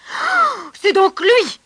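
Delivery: loud, high raging king; furious outburst. Acted. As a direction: explode in royal fury. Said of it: "s'emporta le roi". (le roi s'emporte, furieux et fort)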